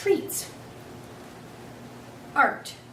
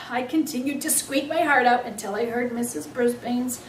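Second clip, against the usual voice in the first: ordinary delivery, nothing marked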